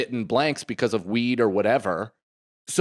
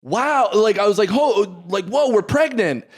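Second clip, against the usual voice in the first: higher, louder, thrilled "whoa, we're pregnant"; excited; 8 semitones higher, 3 dB louder than usual. Retold or imitated. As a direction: burst out with joyful surprise. Excited discovery retold.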